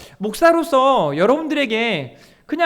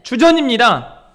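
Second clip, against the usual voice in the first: loud lead line for the crowd to repeat; call-and-response lead; 7 dB louder than usual. Shown as a demonstration. Pastor leading the congregation to repeat, loud call. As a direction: say it out for them to echo back. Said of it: "한번 따라해볼까요?".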